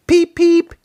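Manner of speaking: very high, loud engine whistle; surprised whistle. A narrator's reading. Thomas waking to a decorated shed, high loud surprised whistle. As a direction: peep like a startled little engine. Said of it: "he whistled in surprise".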